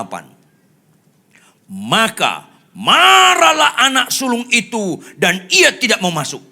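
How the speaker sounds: loud, high, stretched words; full-force preaching. Real, not acted. Preacher reading out the elder son's anger, forceful.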